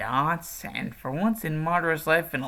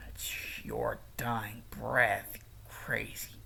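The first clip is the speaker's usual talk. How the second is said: menacing whisper; 5 semitones lower, 10 dB quieter, slower than usual. Acted. Villain's menacing threat.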